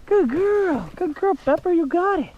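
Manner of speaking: high excited praise for the dog; squeaky praising a dog. Real, not acted.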